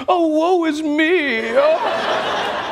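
high, slow mock lament; mock self-pity. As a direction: wail it like a theatrical victim. Mocking Trump's self-pity.

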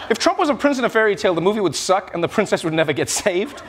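ordinary delivery

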